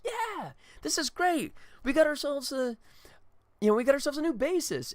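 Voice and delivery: high, stretched sitcom delight; delighted quartet member. Retold or imitated. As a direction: ham up the joy of finding a bassist. Quartet members cheering their new bassist.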